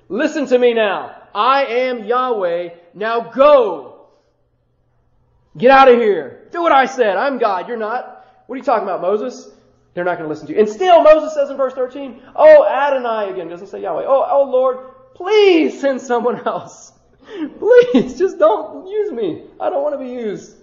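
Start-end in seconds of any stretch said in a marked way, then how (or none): 0.1-10.6 louder, commanding divine rebuke; stern God. Acted. God scolds the reluctant Moses. 12.3-20.6 higher, louder pleading; whining plea. Acted. Moses begs to be spared.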